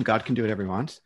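ordinary delivery

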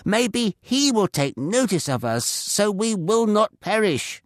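high, loud, urgent command to wake up; urgent shout. A narrator's reading. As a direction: rouse him with a loud, desperate order. Captain shaking Jonah awake.

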